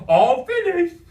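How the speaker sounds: high, loud cartoon shout; hollered. A narrator's reading. Imaginary friend flounces in with his drawing, hollering. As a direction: burst in with a zany holler. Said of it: "hollered Lou".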